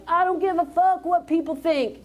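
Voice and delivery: a hint of a cocky, pompous motivator; Gary Vaynerchuk. Speaker imitating a motivator to the audience, mildly brash. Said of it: "this little pompous, doesn't care".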